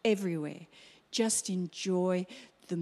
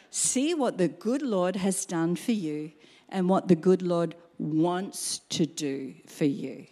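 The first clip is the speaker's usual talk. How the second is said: ordinary talk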